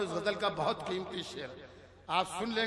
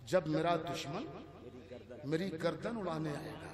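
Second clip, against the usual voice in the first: soft, low recitation; relaxed verse recital; 5 semitones lower, 5 dB quieter than usual. Acted. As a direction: lay the line out quietly. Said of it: "किसी शेर को आराम से पढ़ूंगा". (धीरे से, नीची आवाज़ में)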